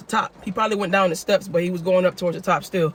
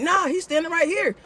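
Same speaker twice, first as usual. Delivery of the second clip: excited; 10 semitones higher than usual. Retold or imitated. Retold reply to dispatcher.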